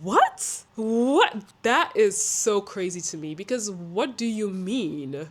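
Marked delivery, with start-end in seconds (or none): 0.0-1.4 stunned rising question; incredulous. Acted. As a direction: blurt it out in disbelief. Reacts in disbelief to the remark.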